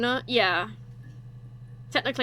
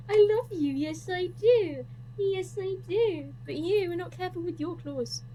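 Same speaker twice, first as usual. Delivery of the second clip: pet talk; 7 semitones higher, slower than usual. Real, not acted. Streamer cooing love to her cat, slow high baby talk. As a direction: coo it slowly, much higher.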